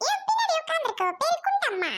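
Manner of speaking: very high put-on character squeal; teasing introduction. Mockingly introduces woman behind. (உச்சக் குரலில் கிண்டல்)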